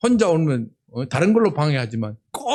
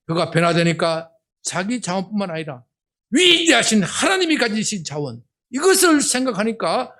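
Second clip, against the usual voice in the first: usual speaking voice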